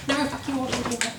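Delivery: put-on girl's defiance. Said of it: "she's like".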